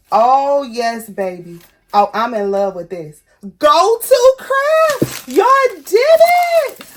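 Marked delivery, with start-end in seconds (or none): 3.6-6.8 much higher, louder, slow, sung-out cheer; ecstatic praise. Real, not acted. Cheering the brand for the perfect press, high slow ecstatic cheer. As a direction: sing out the praise in delight.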